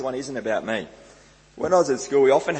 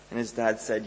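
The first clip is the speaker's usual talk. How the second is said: ordinary delivery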